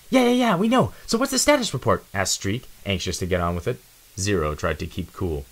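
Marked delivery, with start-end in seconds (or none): 0.1-2.0 high, quick and cocky; a restless kid who can't wait; high, impatient youngster; eager young mouse. Acted.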